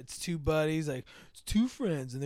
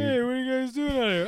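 mock calling friend; 8 semitones higher, 6 dB louder than usual. Voicing the friend in movie.

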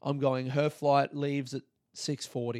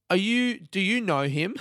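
higher, louder confused question; baffled clerk; 5 semitones higher, 5 dB louder than usual. Retold or imitated. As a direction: play her dim bewilderment.

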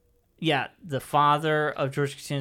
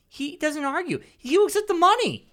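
excited; 13 semitones higher, 4 dB louder than usual. Real, not acted. Excited retelling, voice jumps up.

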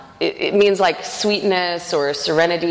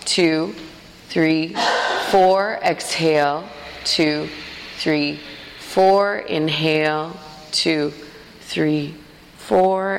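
evenly spaced counting, each word drawn out; unhurried, rhythmic yoga count; breath count; much slower than usual. Shown as a demonstration. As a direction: lead slow breath and movement by counting. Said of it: "movement and breath to the count of four".

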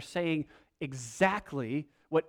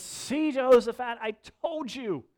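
much higher and louder, a foot-stamping king; petulant complaint; 8 semitones higher, 6 dB louder than usual. Retold or imitated. Ahab griping to Jehoshaphat about Micaiah, petulant. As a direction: whine it like a sulking child-king.

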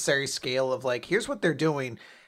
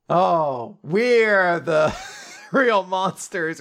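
drawn-out, louder hammed-up revelation; mock realization; 3 semitones higher, 5 dB louder, much slower than usual. Joking that characters see themselves in a mirror, mock-dramatic. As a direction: ham up a very slow epiphany with a laugh.

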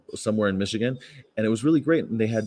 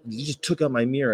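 normal talking voice

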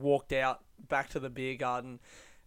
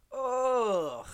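disgusted groan; 9 semitones higher than usual. Real, not acted.

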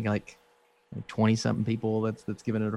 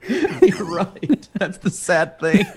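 talking through laughter, higher pitch; laughing; 7 semitones higher, much slower than usual. Real, not acted. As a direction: laugh your way through the words. Laughing at co-host's joke.

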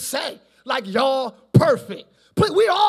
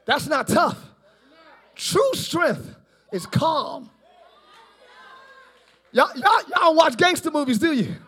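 ordinary talk, nothing marked

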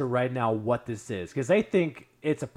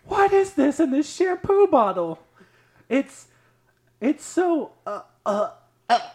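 very high, loud yell breaking into retches; hammed-up horrified shriek with gags; gagging shriek; 10 dB louder than usual. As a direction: imitate someone screaming and gagging in disgust. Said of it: "you just hear her gagging".

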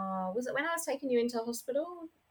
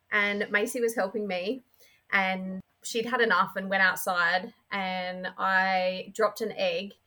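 normal talking voice

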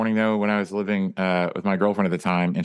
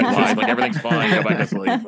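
1 mock dad; 2 semitones higher than usual. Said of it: "He says".

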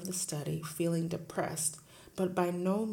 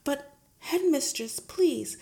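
higher, pleading teacher; 9 semitones higher, 8 dB louder than usual. Acted. Teacher begging her headmistress, higher. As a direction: beg her to listen.

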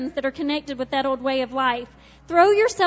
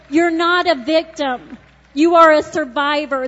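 forceful declaration; 6 dB louder than usual. Acted. Forceful preaching.

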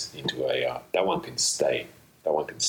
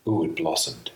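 ordinary delivery

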